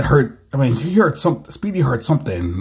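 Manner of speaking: plain reading voice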